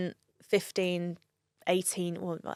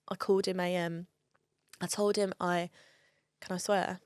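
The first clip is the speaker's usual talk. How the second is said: ordinary talk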